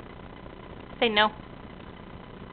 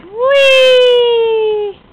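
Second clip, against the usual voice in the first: high, loud delighted squeal; playful whee; 11 semitones higher, 7 dB louder than usual. Acted. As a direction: let it fly like on a swing. Swinging the toddler.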